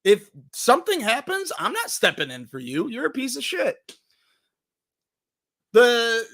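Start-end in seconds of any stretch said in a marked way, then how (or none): 0.0-4.0 voicing the fed-up friend. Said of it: "that friend is like".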